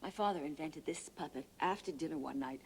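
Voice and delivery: ordinary delivery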